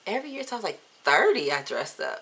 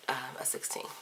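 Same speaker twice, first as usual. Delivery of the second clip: embarrassed whisper; 10 dB quieter than usual. Real, not acted. Admitting a bad test score.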